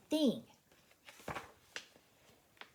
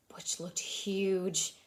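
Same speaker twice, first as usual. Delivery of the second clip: slow ominous; 3 semitones lower, much slower than usual. A narrator's reading. Narrator builds suspense.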